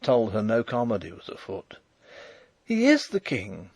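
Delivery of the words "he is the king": high, insistent cry. A narrator's reading. Princess refusing the marshal's denial, crying out. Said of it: "she cried".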